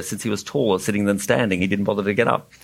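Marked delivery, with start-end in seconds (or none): none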